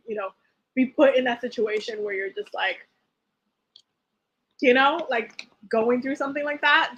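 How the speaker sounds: ordinary talk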